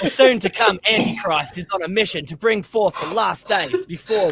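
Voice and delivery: hammed-up Aussie accent, pitched high; Steve Irwin. Reading the blurb to laughing friends, mock Aussie accent. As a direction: read it in an exaggerated, high Australian accent, slowing on phrases. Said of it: "I can't even do Steve Irwin's accent".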